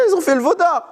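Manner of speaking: high solemn divine voice; God's voice. Acted. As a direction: speak as God declaring it. Voicing God's words in lesson.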